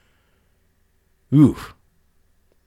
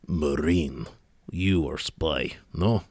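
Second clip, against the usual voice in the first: put-on Russian accent; 5 semitones lower, 5 dB quieter, much slower than usual. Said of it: "Don't be too impressed with my Russian accent".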